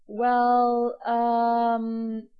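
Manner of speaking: stalling start, then dropping away; reluctant, awkward admission; hesitant mutter. A narrator's reading. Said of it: "muttered Jack".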